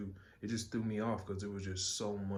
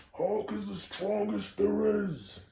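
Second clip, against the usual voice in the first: slow, loud, high roaring hero voice; booming Hulk voice; 12 semitones higher, 7 dB louder, slower than usual. Retold or imitated. Quoting the Hulk catchphrase, slow booming roar. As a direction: bellow it like a cartoon Hulk.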